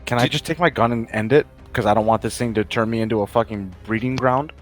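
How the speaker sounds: usual speaking voice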